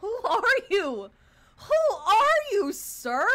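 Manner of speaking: loud, high, stretched-out demand; incredulous shouting. Pretend. Streamer confronting unknown character on screen, incredulous loud demand. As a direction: yell it slowly in disbelief.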